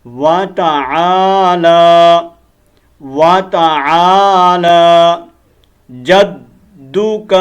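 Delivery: وَتَعَالَى slowly, with a long lam; correct slow recitation. Shown as a demonstration. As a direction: model وَتَعَالَى جَدُّكَ slowly. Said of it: "لام کو ہم نے لمبا کر کے پڑھنا ہے". (لام لمبا کر کے آہستہ پڑھیں)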